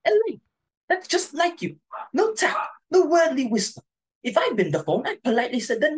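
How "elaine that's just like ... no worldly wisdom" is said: scolding. Acted. Mother scolding daughter.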